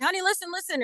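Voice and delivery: mock calling out to get someone's attention; retold excited yell. Wife calling her husband to hear her narration, excited yell. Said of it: "I yelled, I was like".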